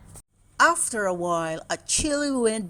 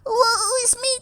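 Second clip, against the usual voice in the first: hammed-up piping wail. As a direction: wail along like the tiniest chick. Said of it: "pipped the smallest chicken".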